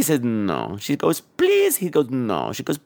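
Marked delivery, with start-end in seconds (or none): 0.2-0.8 low put-on man's voice; mock-grave refusal, pitched down; antique shopkeeper. 1.2-1.8 put-on high, begging girl voice; imitated pleading, pitched up; mock whining. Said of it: "stop whining". 2.1-2.5 low put-on man's voice; mock-grave refusal, pitched down; antique shopkeeper.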